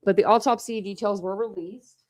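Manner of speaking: ordinary talk, nothing marked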